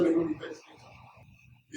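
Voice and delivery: hushed and drawn out, barely voiced; slow whisper. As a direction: whisper it slowly, as a secret plea. Repeated line, whispered slowly.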